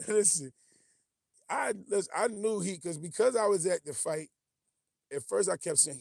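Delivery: loud, worked up. Real, not acted. Heated story of the fight.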